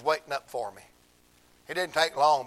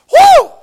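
a loud, very high whoop of praise; joyful whoop; 24 dB louder than usual. Real, not acted. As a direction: let out a celebratory holler. Preacher's joyful outburst.

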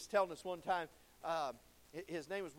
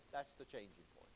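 quiet aside; 10 semitones lower, 10 dB quieter than usual. Real, not acted.

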